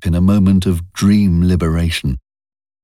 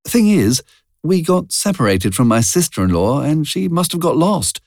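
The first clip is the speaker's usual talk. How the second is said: put-on a young woman's voice, pitched clearly higher; Jean's higher voice; 10 semitones higher than usual. A narrator's reading. Jean telling Charlie about the theatre, higher young voice. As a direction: voice Jean light and chatty, higher up.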